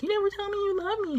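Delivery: high, fast, whiny mother's complaint; a hint of a sulking mom; imitating the mom. Mother teasing her son on camera, high whiny complaint. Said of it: "She was like".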